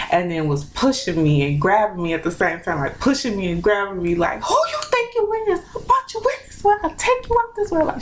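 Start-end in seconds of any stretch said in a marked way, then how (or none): 4.4-7.8 high-pitched mock angry mom; mom going in hard. Mother pushing and grabbing her child, imitated going in hard. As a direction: imitate a scolding mother in a much higher voice. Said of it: "she was going in hard".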